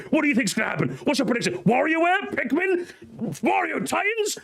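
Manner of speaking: high, eager rapid-fire guesses; excited friends speculating. Retold or imitated. Friends speculating before the Direct, high and eager. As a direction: pitch way up, buzzing with hype. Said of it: "my friends just going".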